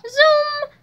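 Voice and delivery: high, loud, drawn-out zoom; imitated zoom. A narrator's reading. Boys speeding in on skateboards, zooming high and loud. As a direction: make a whooshing speed noise.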